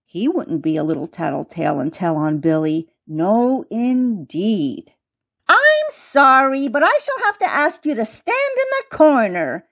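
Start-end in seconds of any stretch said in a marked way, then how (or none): 5.5-9.7 high, regretful mouse voice; apologetic but firm, pitched up; Lady Mouse teacher. A narrator's reading. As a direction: pass sentence as a squeaky schoolmistress. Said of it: "said the Lady Mouse".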